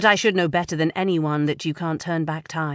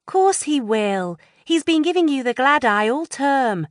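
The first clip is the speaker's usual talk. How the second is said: put-on higher teen friend voice; Lou's voice; 9 semitones higher, 5 dB louder than usual. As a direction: play the bossy best friend. Friend Lou voiced in dialogue.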